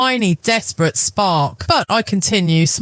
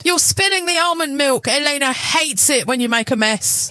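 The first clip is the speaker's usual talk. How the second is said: cold wife voice; 9 semitones higher than usual. Acted. Narrator voices herself coldly.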